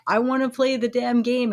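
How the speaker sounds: lightly acted frustration at the game; mock frustrated player. Player arguing with the game's narrator, mock frustrated.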